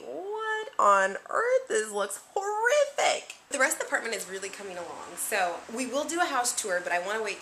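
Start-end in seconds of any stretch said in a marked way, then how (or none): 0.1-3.3 much higher, slow shocked gasp; high slow hammed-up shock; mock horror. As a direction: gasp in fake horror at the mess.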